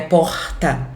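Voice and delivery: Porta with a throaty guttural R. Shown as a demonstration. Teacher demos the Centro-Oeste R in Porta. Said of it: "a gente tem esse R gutural".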